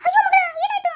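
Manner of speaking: high, loud cry of concern; alarmed parent. Acted. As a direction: call out in alarm to a distressed child. Alarmed parent rushing to crying daughter.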